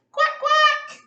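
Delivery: high, loud quacking like a duck. Acted. Hippo quacking like a duck before jumping, high and loud. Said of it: "quacked like a duck".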